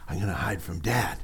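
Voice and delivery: put-on a child's hushed secret whisper; a little kid whispering his hiding plan. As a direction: whisper it like a kid plotting to hide. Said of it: "they're like".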